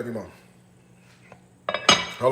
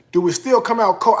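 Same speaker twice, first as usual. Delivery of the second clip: ordinary delivery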